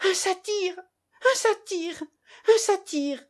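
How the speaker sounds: restrained, high frantic yell; screaming. A narrator's reading. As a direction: cry out high in panic, over and over. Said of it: "on l'entendit hurler".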